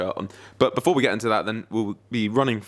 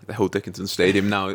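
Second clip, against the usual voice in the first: usual speaking voice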